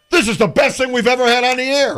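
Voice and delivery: high, loud thrilled exclamation; the delighted general manager, gushing. Retold or imitated. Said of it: "he goes".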